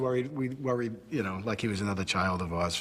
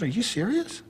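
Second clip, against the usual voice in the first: surprised disbelief; 11 semitones higher, 4 dB louder than usual. Retold or imitated. Hearing Mickey trains fighters.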